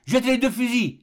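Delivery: high, urgent cry; pressing, high-pitched exclamation; exclaimed order. A narrator's reading. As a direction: call it out sharply as a command. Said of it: "s'écria le docteur".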